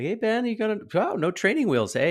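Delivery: put-on high, loud impressed talk to a child; hyping up a kid. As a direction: re-enact admiring a little kid's bike skills. Cheering on child in story.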